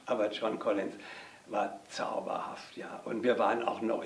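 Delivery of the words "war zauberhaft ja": whispering. Real, not acted. Fond remark about Joan Collins.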